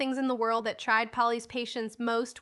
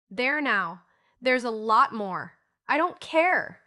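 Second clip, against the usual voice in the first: louder, rising exasperated outburst; frustrated outburst; 7 dB louder, slower than usual. A narrator's reading. Girl snaps at scattering buttons in exasperation. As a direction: lose patience with the buttons. Polly loses patience, buttons flying.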